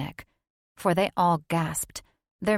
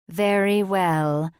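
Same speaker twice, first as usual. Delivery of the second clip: slow, louder, drawn-out witch voice; solemn coven assent, drawn out; witch sisters in unison; 5 dB louder, slower than usual. A narrator's reading. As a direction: intone it slowly like a coven. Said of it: "the sisters answered in unison".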